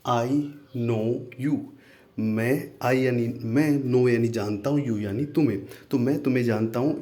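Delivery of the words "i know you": very slow, word by word; slow teacher demo; slow model phrase. Shown as a demonstration. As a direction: say it slowly for the class to copy. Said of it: "इसको English में बोलेंगे हम".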